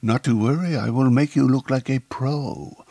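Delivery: put-on a Frenchman promising to make her look good, light French accent; suave French accent. A narrator's reading. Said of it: "With a suave French accent".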